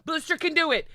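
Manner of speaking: usual speaking voice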